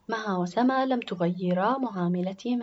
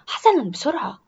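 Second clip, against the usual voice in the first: higher, loud, brisk agreement; hurrying schoolgirl; 5 semitones higher, 9 dB louder than usual. Acted. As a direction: agree but hurry her along. Lora agrees, hurrying her sister.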